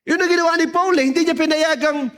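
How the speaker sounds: mataas at madiing boses ng nangangaral; pangangaral. Real, not acted. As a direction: mangaral nang buong sigla sa kongregasyon. Preaches after the testimony. (mataas na tonong pampulpito)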